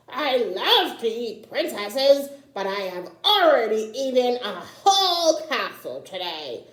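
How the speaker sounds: a smug dragon brushing off a princess. Acted. Dragon voiced in read story.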